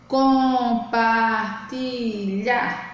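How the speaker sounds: "Compartilhar" very slow: com, par, ti, lhar; teacher sounding out "Compartilhar" for counting; syllable by syllable. Shown as a demonstration. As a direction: stretch each syllable of "Compartilhar". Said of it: "você tem que falar".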